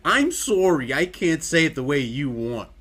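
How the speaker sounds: loud mock apology, Canadian vowels. Apologizing for saying it wrong, mock Canadian accent. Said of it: "I hate Canadian and Australian accents".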